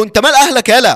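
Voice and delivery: high, heated retort; acted hostile retort. Boy rebuffing the girl's cousin, hostile.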